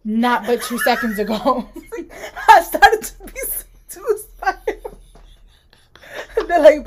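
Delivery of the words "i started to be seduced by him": high-pitched giggly delivery; laughing. Real, not acted. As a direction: talk through a laughing fit. Laughing at her own remark.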